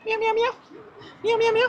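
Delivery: high mock meowing; talking cat to a cat; cat meows. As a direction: meow back at the cat up high.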